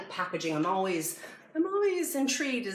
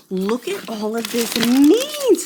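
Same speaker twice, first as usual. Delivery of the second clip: 1 excited; 4 semitones higher, 13 dB louder, slower than usual. Real, not acted. Thrilled unboxing a box of meat.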